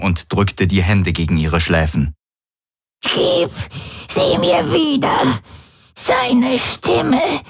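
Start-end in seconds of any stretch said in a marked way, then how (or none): 3.0-5.4 much higher, slow, begging; an old woman pleading through pain. A narrator's reading. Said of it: "wimmerte sie". 6.0-7.4 much higher, tearful cry; an old woman crying for a lost voice. A narrator's reading. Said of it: "wimmerte sie".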